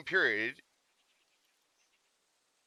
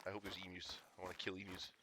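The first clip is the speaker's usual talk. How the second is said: much softer, lower murmured wish; quiet mutter; 6 semitones lower, 16 dB quieter than usual. Real, not acted. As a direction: say it under your breath. Gamer repeats the wish, quietly.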